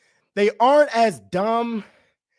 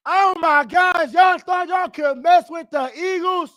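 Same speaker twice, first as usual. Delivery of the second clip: taunting shout; 7 semitones higher than usual. Real, not acted. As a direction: rub it in their faces. Taunting rival fans.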